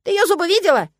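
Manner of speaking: high, loud squeaky protest; indignant squirrel. A narrator's reading. Squirrel protests about those teeth, indignant squeal. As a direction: squeal in outrage. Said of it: "возмутилась белка".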